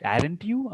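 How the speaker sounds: upturned "you"; rising tag question. Shown as a demonstration. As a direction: voice lifts on "you". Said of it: "rising tone is there".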